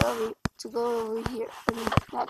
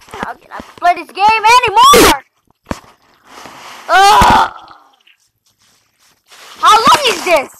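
raging complaint; 4 semitones higher, 6 dB louder than usual. Real, not acted. Gamer raging at parkour.

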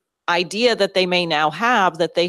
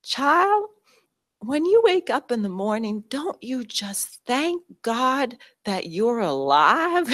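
much higher, slow Southern scolding, imitating the old coach; Southern accent; 7 semitones higher, slower than usual. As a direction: gently chide like an old Southern teacher. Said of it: "And she says".

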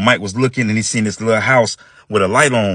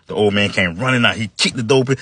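ordinary delivery

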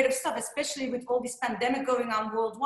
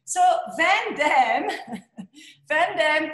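laughing; 4 semitones higher, 6 dB louder, slower than usual. Real, not acted. Laughing over the famous surname.

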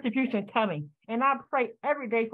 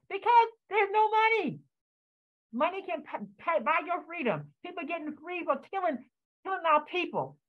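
higher pitched, distressed and straining; upset, voice rising; 6 semitones higher than usual. Real, not acted. Elder on Zoom grieving the killings, voice high with distress. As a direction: let the pain push the pitch up as it spills out. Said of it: "So I'm very emotional".

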